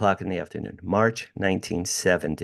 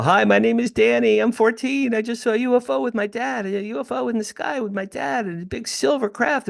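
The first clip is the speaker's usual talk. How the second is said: put-on much higher, breathless boyish voice; eager teenage boy; 14 semitones higher than usual. Fourteen-year-old calling the newspaper to report the UFO, high and eager. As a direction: play his fourteen-year-old self, pitched way up and eager.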